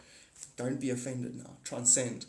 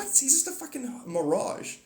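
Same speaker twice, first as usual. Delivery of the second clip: mocking scoff; 9 semitones higher than usual. Mocking reply to critics.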